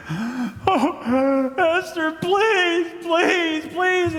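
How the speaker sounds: high, wailing mock begging; hammed-up high whimpering plea. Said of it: "starts pleading with her, begging".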